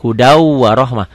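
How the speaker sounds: "Hudau warohmah" linked by a held nasal w; idgham reading. Shown as a demonstration. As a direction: lean on the doubled w into "warohmah". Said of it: "Di atas wawunya ada sidahnya". ("Hudau warohmah", w ganda ditahan)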